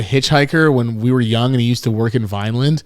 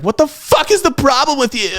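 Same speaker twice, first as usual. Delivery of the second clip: very high-pitched mock outrage; imitated indignant outburst, pitched way up; mimicked outraged date; 12 semitones higher than usual. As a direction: squeal it like an appalled date. Retold angry date after a crash.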